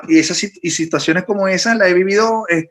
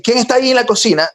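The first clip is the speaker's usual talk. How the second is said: high-pitched call into the dark kitchen; mock call out; 8 semitones higher, faster than usual. A man retelling how he tried to call toward the kitchen, high-pitched mock call. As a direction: call out high, half-acted, asking who's there. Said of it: "entonces yo quería decir".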